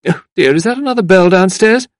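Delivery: high, loud fussy exclamation; flustered visitor. A narrator's reading. Visitor hearing another bell downstairs, flustered. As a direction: fret at a sudden interruption.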